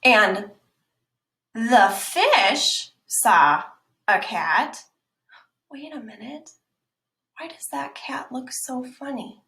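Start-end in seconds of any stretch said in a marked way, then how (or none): 1.5-3.6 slow, loud stress on "fox"; slow storybook emphasis; slow emphatic reading. Shown as a demonstration. Reading italic lines slowly. 5.7-9.4 curious hushed aside. Acted. Wondering aside.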